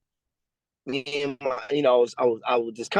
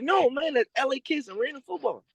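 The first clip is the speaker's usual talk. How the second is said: higher-pitched, emphatic retold correction; correcting him; 8 semitones higher than usual. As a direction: coach correcting the player about the team, high and insistent. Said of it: "He was like".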